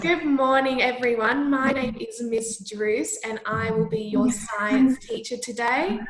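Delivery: loud classroom teacher voice; kindergarten teacher voice. Acted. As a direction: call the whole class to attention. Teacher addressing class of children.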